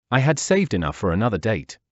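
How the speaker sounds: usual speaking voice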